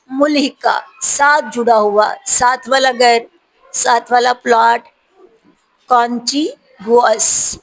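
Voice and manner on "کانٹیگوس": slow repeat. Shown as a demonstration. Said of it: "teacher repeating word slowly".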